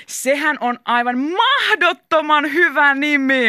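high, loud, stretched-out cheer; big drawn-out exclamation; excited shout. A narrator's reading. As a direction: shout it slowly with great enthusiasm. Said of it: "huudatti Liisa".

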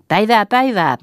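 high, loud hello to acquaintances; hearty greeting. A narrator's reading. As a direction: call out your greeting to the whole group. Newcomer calls a greeting. (reippaasti, kovaa tervehtien)

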